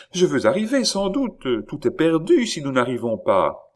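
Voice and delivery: high, agitated woman's voice; anxious pleading. A narrator's reading. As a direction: answer urgently, pitch well up. Chon pleads with her brother. (réplique aiguë et agitée)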